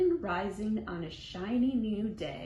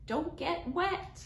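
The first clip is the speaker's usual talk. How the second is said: mama hollering; 7 semitones higher than usual. Retold or imitated. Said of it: "Mama hollers".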